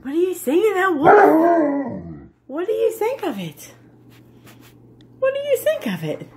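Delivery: high-pitched question to the dog; coaxing pet talk. Real, not acted. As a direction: ask sweetly in a high voice.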